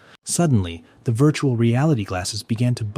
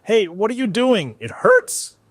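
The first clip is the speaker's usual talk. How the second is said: much higher, louder yelp of pain; boy shocked by stun gun, yelling; pained shout; 13 semitones higher, 7 dB louder than usual. A narrator's reading. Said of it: "he shouted".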